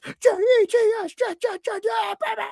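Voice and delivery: high-pitched fan chant; hyped-up Jets cheer, voice way up; going nuts. Retold or imitated. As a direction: re-enact a busload of fans going wild. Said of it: "we're going nuts".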